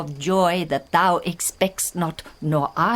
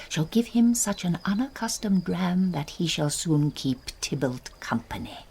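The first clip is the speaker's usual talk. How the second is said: ordinary delivery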